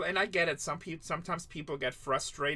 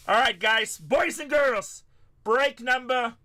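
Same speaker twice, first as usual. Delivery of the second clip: put-on drawn-out, higher, louder announcer call to the boys and girls; hype announcer call; 7 semitones higher, 7 dB louder, slower than usual. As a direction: stretch "boys" like a ring announcer. Hyping viewers before a break.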